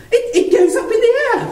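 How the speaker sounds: put-on high, excited little boy voice, laughing; excited child. Nephew showing his uncle an invention, high and excited. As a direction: squeal it like a proud small nephew.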